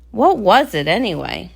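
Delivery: usual speaking voice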